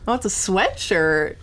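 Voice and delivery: ordinary talk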